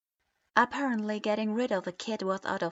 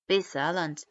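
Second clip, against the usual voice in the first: loud, low cutting order; annoyed command; 3 semitones lower, 4 dB louder than usual. A narrator's reading. Itachi silencing the room, annoyed command. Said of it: "Itachi's voice suddenly cut through".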